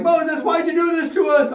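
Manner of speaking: high, loud mock wail; complaining. Israelite slaves blame Moses, high loud complaint. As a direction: protest as an aggrieved crowd. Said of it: "I could hear them complaining".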